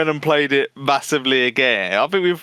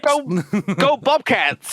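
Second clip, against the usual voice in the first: high, drawn-out mock cheer; college girl; 7 semitones higher, slower than usual. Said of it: "these girls that are going to college".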